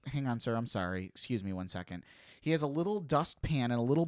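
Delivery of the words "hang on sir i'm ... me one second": soft, apologetic clerk, voice lowered; hushed, overly courteous apology to a customer; polite young cashier. Retold or imitated. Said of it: "and the young man goes".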